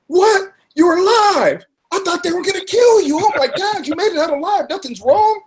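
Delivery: high-pitched amazed exclaiming; mock astonished crowd. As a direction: play the shocked onlookers, way up high. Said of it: "they go".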